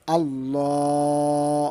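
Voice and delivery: আল্লাহ sung slowly with the final vowel stretched long; slow Quranic recitation; drawn-out recitation. Shown as a demonstration. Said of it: "কিভাবে উচ্চারণ করব".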